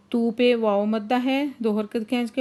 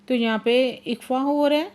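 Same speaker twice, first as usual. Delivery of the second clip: ordinary talk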